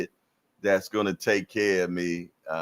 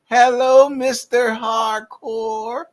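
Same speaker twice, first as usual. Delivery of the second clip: put-on much higher sweet child voice, slow on Mister Hardcore; little girl's greeting; 8 dB louder, slower than usual. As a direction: imitate a small girl chirping hello. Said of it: "She said".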